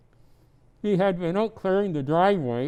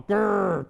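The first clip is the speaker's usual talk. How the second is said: growl; 3 semitones higher than usual. Acted. Tree noises.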